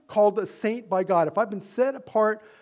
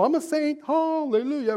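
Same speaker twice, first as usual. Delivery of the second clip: high, sing-song mock saint; hammed-up pious boast; mock holier-than-thou; 8 semitones higher than usual. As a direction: tease the self-righteous pose. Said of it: "they think what we're saying".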